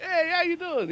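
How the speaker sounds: very high mock greeting; bystanders' friendly greeting. Everybody around Kingpin says hi, high-pitched greeting. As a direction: pitch the hello way up. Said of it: "But everybody's like".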